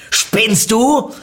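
loud, high outraged shout; angry yell. A narrator's reading. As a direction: yell it as an irate car owner. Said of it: "schrie mich der Besitzer".

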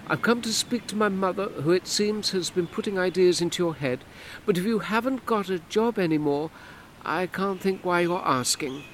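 annoyed woman's voice; Hortense, irritated. Acted. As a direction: shut the journalist down. Hortense brushes him off.

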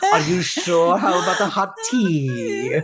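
put-on Frau Brucher German accent. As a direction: press the offer of tea in a heavy German accent. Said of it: "She's doing Frau Brucher from, from Young Frank".

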